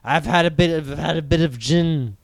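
a tipsy English master pleading at the door; slurred, self-pitying, begging to be let in; drunk Obi-Wan. Acted.

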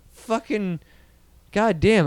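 normal talking voice

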